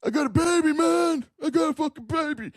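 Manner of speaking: high mocking whiny voice; sneering imitation of a complaining man; mocking whine. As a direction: parrot him back in a shrill mock whine. Mocking the other man's complaint.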